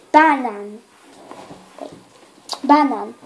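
banan with no final a, stress on first syllable; Slovak word. Shown as a demonstration. Child corrects herself, saying banan carefully. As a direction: say banan short, ending on the n. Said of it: "So I repeat".

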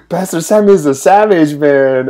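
high laugh breaking through the words; can barely get it out for laughing; giggling at the joke, laughter spilling over. Real, not acted. Laughing at the comment.

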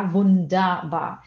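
Wunderbar with the final er said as an open a, vundaba. Shown as a demonstration. Teacher showing students German er ending, slow and clear. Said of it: "som de E R é som de A".

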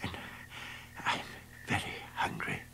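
faint moan. Acted. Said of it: "moaned the dog".

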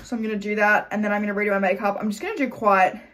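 usual speaking voice

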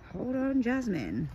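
ordinary talk

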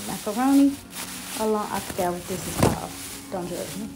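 ordinary delivery

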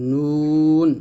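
নুন with a long, held vowel; tongue-tip noon. Shown as a demonstration. Teacher showing the letter noon, drawn-out নুন. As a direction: stretch নুন, tongue tip on upper palate. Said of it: "তালুর সঙ্গে লাগিয়ে নুন উচ্চারণ করব".

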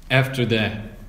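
ordinary delivery, nothing marked

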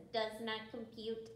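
even, mechanical monotone; robot voice. Shown as a demonstration. Teacher reminds kids of the line, robot voice. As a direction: speak like a neutral machine. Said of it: "Practice your robot voice".

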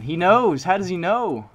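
loud high exasperation; exasperated outburst. Real, not acted. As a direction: complain loudly, baffled. Enemy outplays him.

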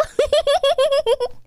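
high-pitched gloating cackle; high villain cackle. Acted.

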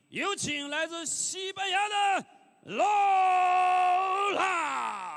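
high shouted fighter intro; boxing ring announcer. Real, not acted. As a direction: belt out the fighter's name.

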